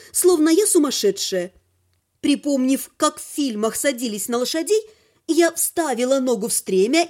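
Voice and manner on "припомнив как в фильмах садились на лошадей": usual speaking voice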